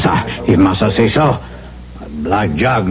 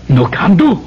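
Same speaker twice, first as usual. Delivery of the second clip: rising protest; 6 semitones higher, faster than usual. Acted. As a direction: push back harder each line. Refusing, growing louder.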